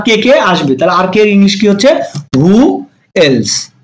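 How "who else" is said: long gap between Who and else; spaced-out teaching delivery; word by word. Shown as a demonstration. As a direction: present each word separately. Teacher models new phrase slowly.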